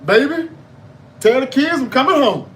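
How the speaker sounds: high, loud, emotional wrestler promo; Mark Henry. Retold or imitated. Mark Henry's mock retirement speech to his wife, loud and hammed-up. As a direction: call home loudly from the wrestling ring. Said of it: "He comes out".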